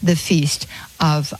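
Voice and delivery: ordinary talk, nothing marked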